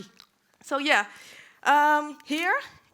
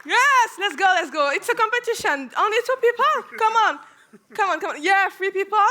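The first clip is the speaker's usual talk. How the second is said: excited cheering; 5 semitones higher, 5 dB louder than usual. Real, not acted. Pumping up volunteers, laughing.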